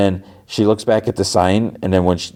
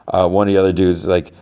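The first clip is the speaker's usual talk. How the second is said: ordinary delivery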